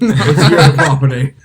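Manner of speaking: slow, loud hammed-up Australian, laughing; Australian accent. Friends goaded him into Australian, laughing Australian accent. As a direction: drawl it Aussie through a laugh. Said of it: "Australian slash British".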